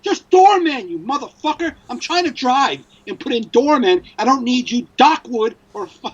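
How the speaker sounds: high-pitched rant at the phone; frustrated. Acted. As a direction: snap at a useless phone, pitch jumping up. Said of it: "it's so frustrating".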